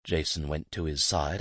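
ordinary delivery

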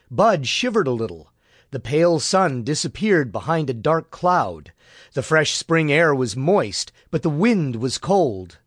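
usual speaking voice

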